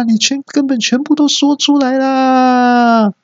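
high, shrill cartoonish exasperation; exasperated shrill voice. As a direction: squeal in disbelief at the blunder. Exasperated outburst. (尖声抓狂的吐槽)

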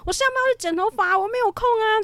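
imitating a high, angry yell; yelled excuses. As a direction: fob someone off in a strained high shout. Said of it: "他就继续爆吼说".

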